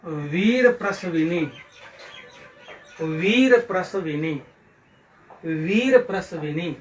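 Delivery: वीर प्रसविनी slowly, with a long वीर; careful pronunciation. Shown as a demonstration. Teacher modelling वीर प्रसविनी for pupils, slow careful pronunciation. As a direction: stretch वीर, then each syllable of प्रसविनी. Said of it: "इन शब्दों को शुद्ध उच्चारण कीजिए".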